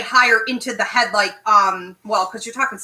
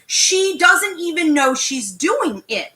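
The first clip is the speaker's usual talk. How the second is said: ordinary talk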